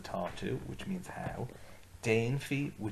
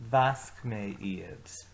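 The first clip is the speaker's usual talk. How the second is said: Vasc mé iad stretched out very slowly; very slow model phrase; 4 dB louder, much slower than usual. Shown as a demonstration. Teacher models Vasc mé iad very slowly for repeating. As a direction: drag out Vasc mé iad word by word. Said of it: "So let's say those ones together".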